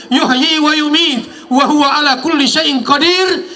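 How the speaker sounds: preaching. Acted. Rising sermon to crowd.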